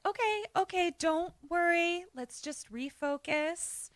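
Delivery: calm the group down softly; drawn-out, hushed reassurance; soothing reassurance. Retold or imitated. Acts host calming the group.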